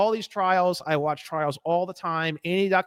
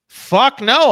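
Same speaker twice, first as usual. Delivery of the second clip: loud, high burst of refusal; defiant shout; 7 semitones higher, 10 dB louder than usual. Acted. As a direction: blurt out a loud, scornful no. Acted defiant reply to official.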